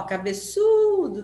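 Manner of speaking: ÇU in "Cabeçudo" long, high and loud; "Cabeçudo" hollered with a stretched ÇU; calling out, ÇU prolonged. Shown as a demonstration. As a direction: call "Cabeçudo" like a name, holding the third syllable. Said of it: "Quem que eu falei mais?".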